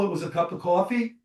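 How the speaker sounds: usual speaking voice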